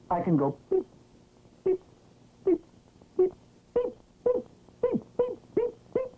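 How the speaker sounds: squeaky high chick beeping; tiny chick peeping, very high; vintage cartoon voice. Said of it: "And suddenly he said".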